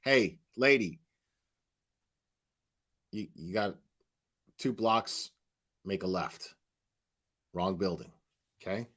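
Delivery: low, soft, halting deadpan; office staffer. Acted. Staffer redirecting a screaming woman, low-key dismissal. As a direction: give directions quietly with pauses, unimpressed. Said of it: "them going".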